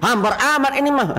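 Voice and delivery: high, loud sneering complaint; scornful intonation. Retold or imitated. Husband mocking wife's curry with a loud high sneer. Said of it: "Apalagi dengan intonasi".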